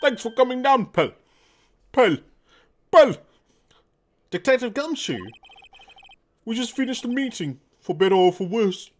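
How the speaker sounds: high, put-on dialogue voice; animated game character, pitched well above normal; game character greeting. Greeting Pearl and a detective after a meeting, high character voice.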